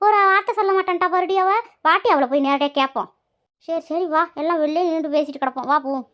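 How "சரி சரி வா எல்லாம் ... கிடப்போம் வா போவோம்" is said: usual speaking voice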